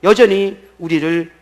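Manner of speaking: high, loud, ringing sermon delivery; full-force preaching. Real, not acted. Pastor proclaiming God awakened the conscience, full-force preaching. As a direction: drive each phrase home to the congregation.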